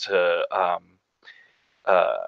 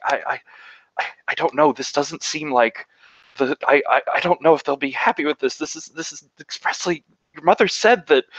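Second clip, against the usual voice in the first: put-on higher, stammering, nervous girl; shaky servant girl; 8 semitones higher, faster than usual. Servant girl fears the mistress won't be happy, nervous and stammering. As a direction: tremble out the excuses. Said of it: "shaking a little bit".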